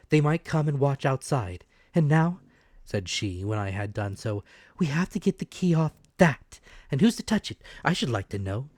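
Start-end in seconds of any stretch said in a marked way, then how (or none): 0.1-2.4 tense, raised, frightened voice; urgent and afraid; anxious mother. Acted. Mother, tense, in the dark house. 4.8-8.7 high, strained, tearful; on the edge of a sob; near-sobbing mother. Acted. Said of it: "she gave a kind of sob".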